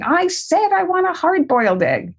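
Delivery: put-on high, whiny kid insisting; imitating an upset grandson demanding his egg; child's demand. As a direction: mimic a stubborn little boy.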